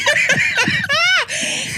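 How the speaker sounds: loud, high-pitched burst of laughter; cackling. Real, not acted. Wife losing it over her own story, loud cackle. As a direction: crack up helplessly at full volume.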